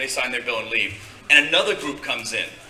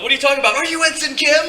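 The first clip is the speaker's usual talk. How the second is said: high, loud imitated fan; excited fan; 13 semitones higher, 6 dB louder, faster than usual. Kid asking the actor at the club, excited high voice. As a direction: blurt it out high and eager.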